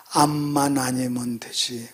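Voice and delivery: slow, low, hushed murmur; imitated dying pastor. Pastor with cancer repeating his saying, hushed murmur. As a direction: let it out slowly, nearly whispered. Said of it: "그분이 항상 입에서".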